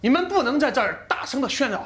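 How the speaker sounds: loud, high-pitched official warning; stern warning. Acted. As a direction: raise your voice and threaten consequences. Official warns quarrelling group. (严厉地高声警告)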